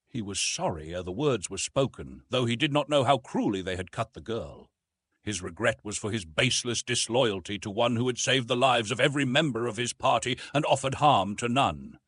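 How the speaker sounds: ordinary delivery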